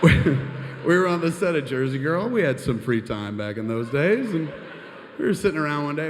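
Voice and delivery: can't keep from laughing; real laughter breaking into the story; laughing while telling. Real, not acted. Cracking up at own story.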